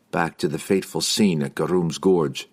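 ordinary delivery, nothing marked

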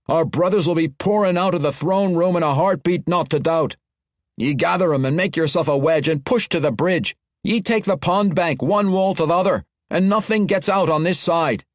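gruff dwarf commander. Acted. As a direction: command the wedge, brogue thick. Dwarf leader giving orders.

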